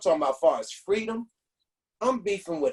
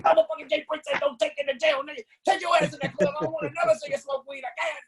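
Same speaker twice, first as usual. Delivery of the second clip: high, squeaky mock cop rant, speeding up; bad cop rant; 9 semitones higher, faster than usual. Cop griping as he lets the rapper go, high mock rant. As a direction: rant like a cartoon bully cop. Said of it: "back into his bad stuff".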